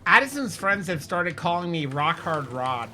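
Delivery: ordinary delivery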